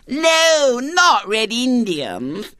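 put-on very high, drawn-out child refusal; protesting little girl. A narrator's reading. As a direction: object as a dismayed small girl. Little girl rejects his game.

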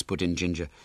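ordinary delivery, nothing marked

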